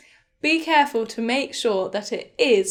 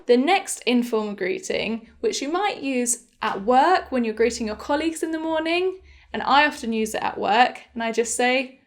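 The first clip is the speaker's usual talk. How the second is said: ordinary delivery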